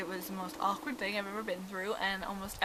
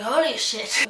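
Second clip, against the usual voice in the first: shocked exclamation; 3 semitones higher, 9 dB louder, slower than usual. Retold or imitated. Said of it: "I was like".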